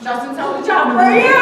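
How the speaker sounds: bursting proud mother's cheer; excited. Real, not acted. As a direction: shout it out with pride. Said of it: "I just get excited about that".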